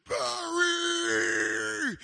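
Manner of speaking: high, loud drawn-out jeer at the player; heckling yell. Retold or imitated. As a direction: bellow his name from the stands. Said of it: "kept getting up and going".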